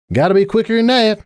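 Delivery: high, laughing taunt; teasing call. A narrator's reading. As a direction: call it over your shoulder. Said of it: "she called with another laugh".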